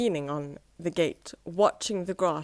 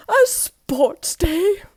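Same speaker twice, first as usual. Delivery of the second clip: high, very slow, louder prissy sniff; fussy gentleman sniffing at the idea, higher and slow; disdainful sniff; 10 semitones higher, 9 dB louder, much slower than usual. Acted. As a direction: sniff the words out in distaste. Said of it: "sniffed Mister Fussy".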